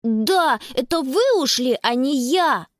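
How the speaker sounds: put-on mildly indignant child. A narrator's reading. Little brother blaming the girls who left, indignant. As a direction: throw the blame back. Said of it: "воскликнул Якоб и яростно застучал".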